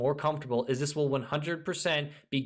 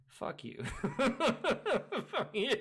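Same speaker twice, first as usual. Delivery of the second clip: laughing curse; 11 semitones higher than usual. Real, not acted. Laughing after mock.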